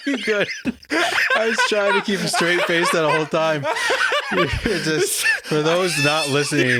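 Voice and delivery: can't keep a straight face; laughing. Real, not acted. Cracks up at sinking co-host.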